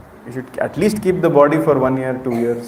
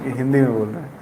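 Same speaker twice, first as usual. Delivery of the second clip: normal talking voice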